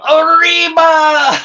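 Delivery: long, high, loud wrestler's yell; yelled war cry. Retold or imitated. Wrestler pulling the Sheik's legs up, long high yell. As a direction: belt out the cry and stretch it. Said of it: "Louis does it one more time".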